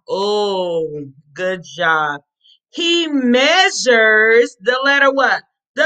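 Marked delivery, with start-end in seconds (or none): 0.1-1.1 "Oh" as a drawn-out L sound. Shown as a demonstration. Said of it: "What's the L sound".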